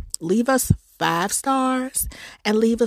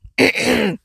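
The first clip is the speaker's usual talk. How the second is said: cough; 4 semitones higher than usual. Real, not acted. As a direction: clear the throat with a cough.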